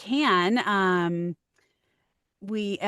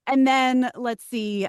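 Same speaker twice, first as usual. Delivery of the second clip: normal talking voice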